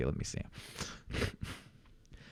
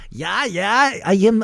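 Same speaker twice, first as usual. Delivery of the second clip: put-on Austrian accent; 8 semitones higher, slower than usual. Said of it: "Austrian, please".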